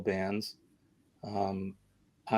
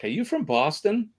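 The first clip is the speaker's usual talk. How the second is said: higher-pitched mock question; imitating curious strangers; 8 semitones higher than usual. Host imitating strangers who hear the accent, high-pitched question. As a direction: ask it high, as someone guessing an accent. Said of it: "they're like".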